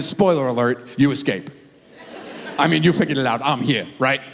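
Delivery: ordinary talk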